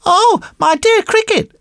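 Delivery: high-pitched puppet greeting; polite puppet boy. Acted. Puppet boy bows to the cricket, high and polite. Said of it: "said Pinocchio".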